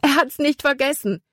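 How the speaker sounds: high, relieved exclamation; excited call. A narrator's reading. Libby sees Jordan keep his head up, excited call. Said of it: "rief sie".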